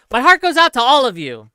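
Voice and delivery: lightly voiced Elon line again; Elon impression. Shown as a demonstration. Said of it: "can you please do an Elon salute impression".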